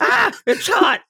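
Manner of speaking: high-pitched comic yelp, drawn out; mock pained yell. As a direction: yelp it high, imitating someone scalded. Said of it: "he's yelling".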